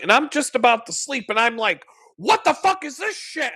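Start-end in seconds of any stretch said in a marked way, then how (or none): 2.1-3.5 much higher, incredulous outburst; outraged disbelief. Retold or imitated. Up at midnight, finding the client's site hacked, high incredulous outburst. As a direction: let your voice jump up in outrage.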